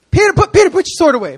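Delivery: high, loud call to stop; urgent command. Retold or imitated. Jesus ordering Peter to stop, loud and high. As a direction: call him off sharply.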